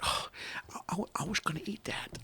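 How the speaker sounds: hushed, whispery actor impression; Owen Wilson. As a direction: whisper it like a let-down movie star. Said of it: "I'm trying to do my best Owen Wilson voice".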